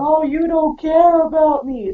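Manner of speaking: imitating a clingy girlfriend as he leaves; mock whining. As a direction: pout it out like a clingy partner. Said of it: "she'll start whining and crying".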